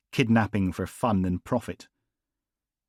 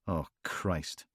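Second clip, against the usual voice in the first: usual speaking voice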